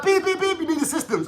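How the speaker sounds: high-pitched beeping shop alarm voice; shop alarm imitation. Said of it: "The alarm went off in Tesco".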